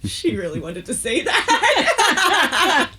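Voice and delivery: laughing through the words, slow; amused, breaking into laughter. Real, not acted. Laughing at friend's answer.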